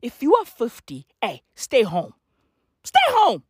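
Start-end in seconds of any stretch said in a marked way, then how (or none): 2.8-3.4 high, emphatic holler; sharply raised, insistent cry; yelled order. Acted. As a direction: yell it like an urgent command. Repeats the order as a yell.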